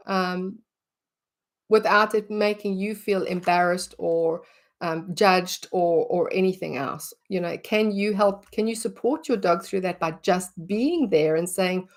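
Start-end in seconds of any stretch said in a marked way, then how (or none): none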